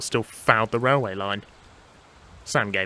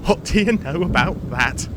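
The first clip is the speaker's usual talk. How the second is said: higher, gleeful mock wonder; amused laughing; 6 semitones higher, 4 dB louder than usual. Percy enjoying Thomas's flight, amused. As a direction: say it tickled and triumphant. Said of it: "he laughed".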